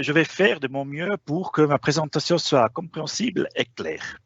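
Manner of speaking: ordinary delivery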